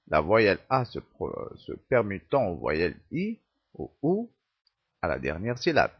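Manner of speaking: usual speaking voice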